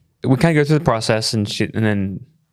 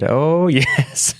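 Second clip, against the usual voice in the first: laughing admission; 6 semitones higher than usual. Retold or imitated. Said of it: "I said".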